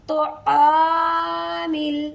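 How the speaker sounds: आमिल very slow with a full, heavy aa; tajweed आमिल with one-alif length. Shown as a demonstration. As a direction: draw out the ain in आमिल. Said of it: "तो आ को पुर पढ़ेंगे".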